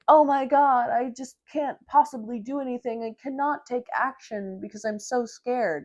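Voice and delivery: sneering impression of a too-scared heroine; mock helpless damsel. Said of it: "the men in these books are like".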